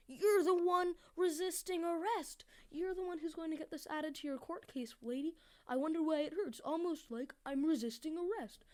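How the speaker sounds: worked-up scolding; heated rant. Real, not acted. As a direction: scold her, voice climbing. Ranting at the woman.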